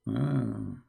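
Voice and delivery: usual speaking voice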